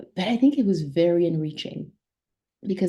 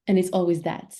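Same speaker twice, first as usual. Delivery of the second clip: ordinary talk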